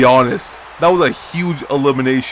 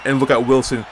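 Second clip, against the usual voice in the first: normal talking voice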